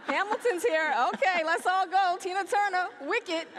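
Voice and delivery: high, loud, excited show-listing; mock eagerness. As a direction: rattle off shows like an eager fan. Acts out eager theatregoer.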